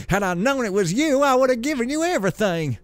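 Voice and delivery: high, pleading excuse; crying out. Acted. Rich novice excusing himself to the builder, high cry. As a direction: protest up high to your lord. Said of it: "cried the rich novice".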